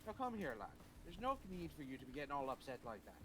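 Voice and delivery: light Irish lilt, coaxing; a hint of an Irish uncle soothing; Irish accent. Shown as a demonstration. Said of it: "Try it".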